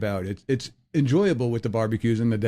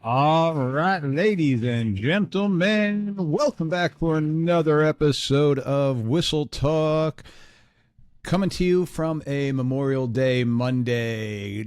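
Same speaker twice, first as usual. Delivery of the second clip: drawn-out, higher announcer welcome; show host welcome; 4 semitones higher, slower than usual. Acted. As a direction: stretch it out like a radio host opening the show.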